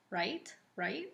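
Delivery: "Right, right?" softly, each rising like a question; questioning tag. Shown as a demonstration. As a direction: quote your habit: "Right, right?", quiet and rising. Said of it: "always say this".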